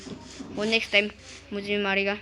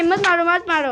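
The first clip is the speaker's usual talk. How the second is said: high, loud don't-kill-me plea; frantic begging; 6 semitones higher, 7 dB louder than usual. Real, not acted. Player begging his friend not to kill him, high loud plea. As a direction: beg for your life in a rush.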